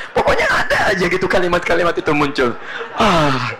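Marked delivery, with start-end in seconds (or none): none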